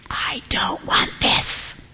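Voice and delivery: slow, whispery complaint; drawn-out whispered protest; reluctant student groan. Acted. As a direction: imitate a student dreading an assignment, voice dropped to a whisper. Students balking at an assignment.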